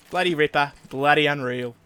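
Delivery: mock Ocker Australian cheer; Ocker Australian accent. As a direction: bellow it like a pub-going Australian fan. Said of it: "our best Australian Ocker impression".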